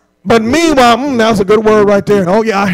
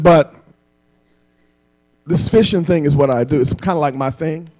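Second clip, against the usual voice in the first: lower, softer, hesitant fisherman; a hint of a polite fisherman speaking up; polite Peter; 8 semitones lower, 4 dB quieter than usual. Peter politely telling Jesus, lightly voiced and hesitant.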